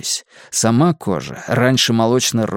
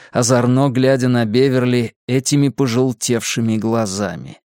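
plain reading voice

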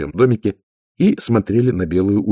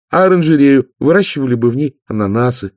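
higher, louder hopeful proposal; eager suggestion; 6 semitones higher, 6 dB louder than usual. Acted. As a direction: float another idea excitedly as the youngest. Youngest sister pushes another eager idea.

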